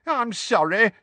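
high, wailing sorry; wailing apology. A narrator's reading. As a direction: wail it out after a clumsy fall. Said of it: "wailed Tonks".

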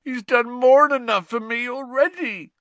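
high, loud, groaning lament. Acted. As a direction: groan the words out. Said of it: "groaned Hagrid".

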